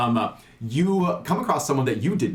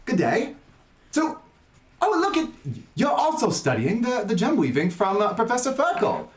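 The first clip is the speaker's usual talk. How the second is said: a hint of Australian, bright and higher; attempted Australian accent; 10 semitones higher than usual. GM voicing Zinni greeting Cyprus, light Australian attempt. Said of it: "I can't do the Australian accent like, uh, Denton, but I am going to try".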